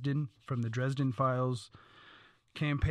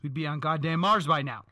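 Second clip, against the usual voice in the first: loud rant; 2 semitones higher, 7 dB louder than usual. Real, not acted. Ranting about NASA funding.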